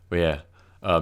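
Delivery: ordinary talk, nothing marked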